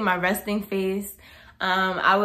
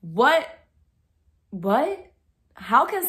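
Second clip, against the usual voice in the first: shocked; 5 semitones higher, much slower than usual. Real, not acted. Shocked at a rude comment.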